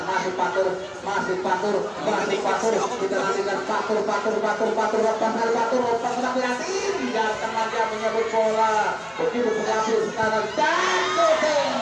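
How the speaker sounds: building excitement. Acted.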